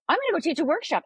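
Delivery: a hint of carefree boldness; mild mock of Sharon's casual announcement; imitating breezy confidence. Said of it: "though, was like".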